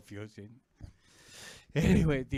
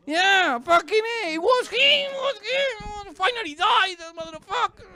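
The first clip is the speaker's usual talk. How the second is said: high, loud gloating cheer; mock jubilant outburst; 5 dB louder than usual. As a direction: celebrate wildly in a high voice. Acts out a rejoicing believer.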